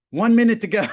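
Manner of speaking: loud, high imitation of a student's call; urgent countdown shout. Student interrupting the teacher with time left, urgent countdown shout. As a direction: shout the last warning across the room.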